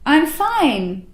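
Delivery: like a morning radio host; bright and confident; buoyant tone. Shown as a demonstration. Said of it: "So when I say".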